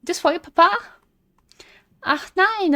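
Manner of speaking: high imitation of a German child; mock German kid voice; German-accented kid. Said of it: "un accent allemand, le gosse".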